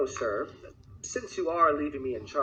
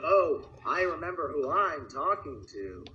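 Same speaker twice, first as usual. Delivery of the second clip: ordinary delivery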